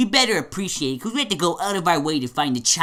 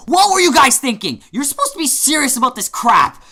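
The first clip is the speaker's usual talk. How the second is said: high, loud outburst of disbelief; indignant high-pitched rant; exasperated scolding; 8 semitones higher, 5 dB louder than usual. Acted. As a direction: yell at your partners for not taking it seriously. Character scolds fellow reviewers over ratings.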